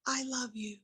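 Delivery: imitating him.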